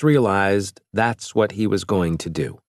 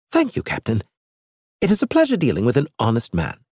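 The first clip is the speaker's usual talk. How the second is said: higher-pitched alien envoy, scornful; lightly raised alien voice; alien Elge; 4 semitones higher, faster than usual. Acted. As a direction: play the proud alien mocking the captain. Elge grateful.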